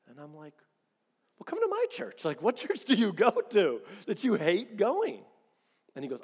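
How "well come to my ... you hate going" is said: incredulous. Retold or imitated. Incredulous reply to hating church.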